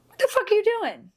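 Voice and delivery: sudden high, loud yell; startled yell. Real, not acted. Bartender yelling at the dog mid-story, high and loud. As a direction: shriek it out in alarm. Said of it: "What was that?".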